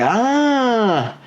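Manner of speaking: put-on the boy finally gets it; realizing ah. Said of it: "And Jimmy's like".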